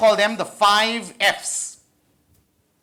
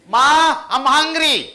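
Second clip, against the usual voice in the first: higher, louder call to mom; calling out hungry; 5 semitones higher, 5 dB louder, slower than usual. Acted. A hungry kid calls to mom from the desk, loud call. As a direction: whine it across the house like a hungry kid.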